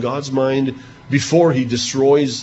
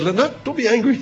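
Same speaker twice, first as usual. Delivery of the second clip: high, nervous plea not to be angry; timid plea; 11 semitones higher than usual. Acted. Abraham begging God not to be angry, in a high nervous plea. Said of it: "He says, Abraham says".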